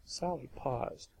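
plain reading voice

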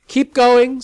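high and loud call. Acted. Sally calling to Lavinia at the wheel, loud. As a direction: shout it to the driver. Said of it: "Sally called".

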